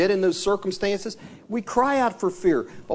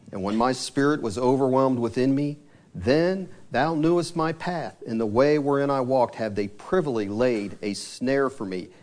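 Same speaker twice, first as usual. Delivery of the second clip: scripture reading; 5 semitones lower than usual. Acted. Reads the psalm aloud.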